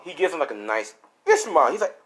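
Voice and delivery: ordinary delivery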